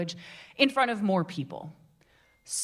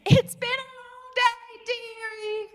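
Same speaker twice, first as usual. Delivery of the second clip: high, slow Cockney character voice; drawn-out Cockney stage voice, way up high; Cockney theatre voice, slow and high; Cockney Mrs Lovett line; 13 semitones higher, slower than usual. Retold or imitated. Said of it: "Sammy laughed on stage and went".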